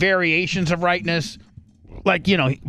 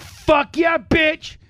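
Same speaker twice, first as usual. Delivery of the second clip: much higher, louder triumphant yell; triumphant shout; 13 semitones higher, 3 dB louder, much slower than usual. Retold or imitated. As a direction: crow over the punch you just landed. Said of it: "And I'm like".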